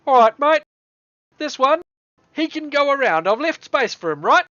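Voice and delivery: put-on loud, high New Zealand dwarf accent. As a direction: argue as a put-out dwarf with a broad Kiwi accent. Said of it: "That's dwarf accent in this world".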